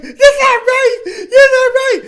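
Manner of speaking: very high, loud, slow fake wail; hammed-up sobbing Karen; mock crying Karen. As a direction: wail it like a Karen bawling over a queue. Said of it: "you see Karen in the mall".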